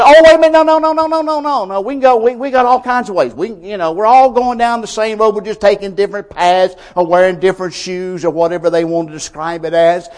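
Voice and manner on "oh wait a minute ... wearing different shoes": lampoon the self-assured rich, brushing it off; a scoffing caricature of people who think every road leads to God; mocking the rich. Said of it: "everybody in the rich will say".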